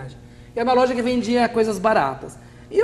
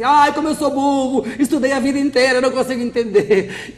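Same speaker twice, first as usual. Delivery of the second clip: mock self-pity; 4 semitones higher, 6 dB louder than usual. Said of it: "você fala assim".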